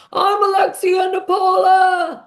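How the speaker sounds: high, slow, loud self-announcement; grandiose mock shout. Alexia announcing herself during the dinner clash, slow loud shout. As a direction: proclaim your own name like a diva.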